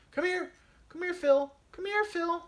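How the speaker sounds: high, sing-song call to a dog; high baby-talk call to a pet. Pretend. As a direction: coax the dog over in a high voice. Calling a dog again.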